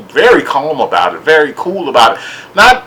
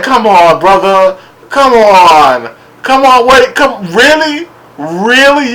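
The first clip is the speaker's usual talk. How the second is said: higher, louder mock disbelief; incredulous; 6 semitones higher, 3 dB louder, slower than usual. Vlogger imitating himself at the ponytail, high incredulous. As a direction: come on, really, pleading disbelief.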